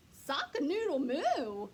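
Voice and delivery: a cow getting the words wrong, loudly; cow's clumsy crow. Acted. Cow copying the rooster, loud and slow.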